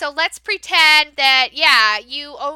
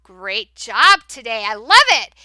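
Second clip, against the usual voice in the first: higher, louder, gushing praise; excited praise; 4 semitones higher, 6 dB louder, slower than usual. Shown as a demonstration. Teacher praising a child who got all right, excited. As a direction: cheer the child on warmly. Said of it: "You'll say".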